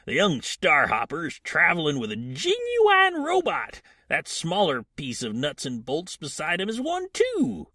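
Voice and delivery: high-pitched, uncultured rider voice; uneloquent scavenger. Acted. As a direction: play an unschooled salvager, high and loud. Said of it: "are not the most eloquent".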